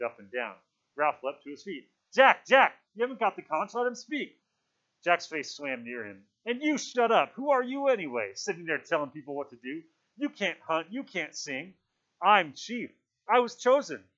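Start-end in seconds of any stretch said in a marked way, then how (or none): 2.1-4.4 high, loud call to order; shouted protest. A narrator's reading. Ralph leaps up to stop Jack, shouting. 6.4-14.1 loud, high, quarrelsome outburst; angry challenge. A narrator's reading. Jack turns on Ralph, angry and loud.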